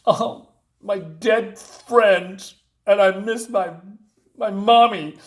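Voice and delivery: milk the fake grief slowly; a hammed-up sad little voice; mock whiny sob story. Mocking a character's sob story.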